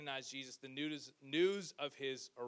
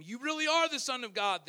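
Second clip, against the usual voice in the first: higher, louder awed exclamation; amazed exclamation; 9 semitones higher, 12 dB louder than usual. A narrator's reading. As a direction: burst out in wonder. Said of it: "they exclaimed".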